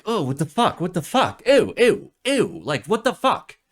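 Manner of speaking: loud, high, grossed-out ew; acted disgust. Reacting to the celebration plans with loud disgust. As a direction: recoil in mock revulsion at the news. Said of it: "a very clear feeling of disgust".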